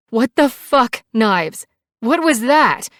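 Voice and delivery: high, loud, outraged demand; angry outburst. A narrator's reading. Shaken passenger confronting biker after skid, angry shout.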